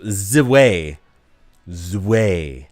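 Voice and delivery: "Z-Way" with a buzzed z running into way; trying out pronunciation. Shown as a demonstration. Streamer testing a German name for chat, trying out pronunciation. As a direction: sound out "Z-Way" as zuh-way, z held. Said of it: "I don't know how accurate that is".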